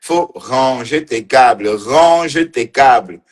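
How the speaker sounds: Range tes câbles dragged out slowly, like a nagging parent; slow mock scold. Teasing the woman on camera, slow mock scolding. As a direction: spell it out slowly, mocking. Repeats mocking order to mamie.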